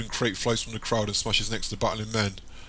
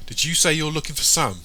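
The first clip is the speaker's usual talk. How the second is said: higher, louder startled question; surprised question; 6 semitones higher, 5 dB louder than usual. A narrator's reading. Tara questions Michael about looking for Sam, surprised. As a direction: ask it with sudden concern.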